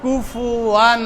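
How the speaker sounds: কুফুয়ান drawn out slowly, the nasal held; clear ghunna. Shown as a demonstration. Teacher models the ghunna for the class, slowly. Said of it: "স্পষ্ট গুণনা হবে".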